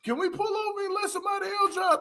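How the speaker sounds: mild mock plea; imitating a scared passenger. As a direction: beg to pull over. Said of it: "somebody said in the car".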